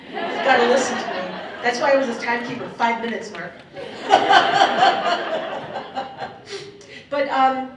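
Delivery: laughing loud and high through the words; bossy joke dissolving into laughter; big laughing teasing. Real, not acted. Joking she's his elder, laughing.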